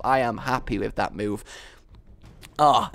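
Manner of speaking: ordinary talk, nothing marked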